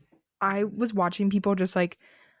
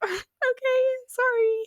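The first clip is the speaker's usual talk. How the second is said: squeaky high timid okay sorry; mock timid high apology; meek high okay; slower than usual. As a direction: shrink into a tiny high voice. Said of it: "I was like".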